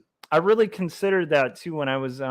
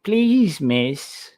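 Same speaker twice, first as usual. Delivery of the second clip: put-on Cockney delivery boy; 3 semitones higher, slower than usual. Urchin begging the miss for labour wages, Cockney plea. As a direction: beg like a Victorian street boy in Cockney. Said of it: "wild impressions of, uh, of delivery boys".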